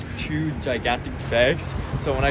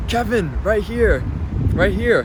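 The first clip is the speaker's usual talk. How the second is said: higher, calling out across the street; retold call from across the road, a bit higher and louder; mock yell; 7 semitones higher, 3 dB louder, slower than usual. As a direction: shout his name over from the other side. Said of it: "He was yelling".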